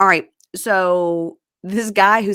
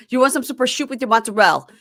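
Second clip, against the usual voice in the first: high, loud, fast Italian-American caricature; Joey; 6 semitones higher, 6 dB louder, faster than usual. Acted. Host joins the Joey joke, broad Italian-American accent. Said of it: "She sounds like Joey".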